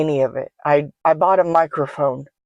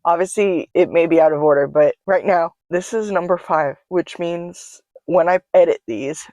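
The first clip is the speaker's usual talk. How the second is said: normal talking voice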